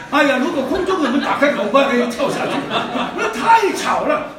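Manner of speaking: laughing while half shouting; laughing exasperation. Real, not acted. Preacher joking to the congregation, laughing. Joking about jumping off the plane.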